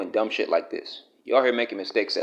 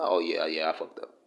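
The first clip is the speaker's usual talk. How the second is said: sheepish admission; 5 semitones lower, 5 dB quieter than usual. Shown as a demonstration. Showing how Shannon should have owned up, softer and sheepish. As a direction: lower and much softer, a mumbled owning up.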